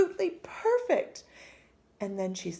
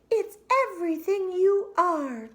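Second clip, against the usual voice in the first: much higher, loud, gushing mother; doting mother; 10 semitones higher, 6 dB louder than usual. Acted. Mother dismisses the teasing, gushing over her daughter's name. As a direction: gush it to wipe away her worry.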